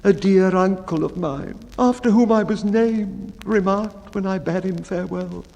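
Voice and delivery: high, meek storyteller voice; the mildest of men pleading to be heard; timid baker. Acted. Baker recounting his sad story to the bellman, high and meek.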